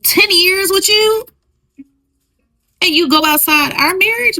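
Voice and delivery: outraged wife hearing the confession; outraged disbelief. Pretend. Wife to cheating husband, high outraged disbelief. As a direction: pitch jumps high, stretched in shock.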